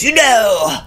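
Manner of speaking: grand, slow imitation with drawn-out words; David. Singer blowing smoke into the car, grandly imitated complaint. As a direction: pronounce it like a doleful verdict, mock-solemn. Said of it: "and goes".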